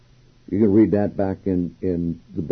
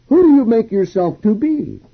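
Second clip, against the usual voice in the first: much higher, louder mocking question; scornful challenge; 13 semitones higher, 5 dB louder than usual. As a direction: throw it at him as an accusation. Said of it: "Today we would say".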